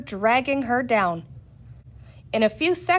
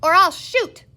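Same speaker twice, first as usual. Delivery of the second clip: high, loud warning shout; 8 semitones higher, 6 dB louder than usual. A narrator's reading. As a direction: shout them back. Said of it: "she shouted".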